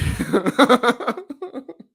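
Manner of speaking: long high loud laugh. Real, not acted. Host reading silly chat comment, loud laughing. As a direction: crack up at the comment.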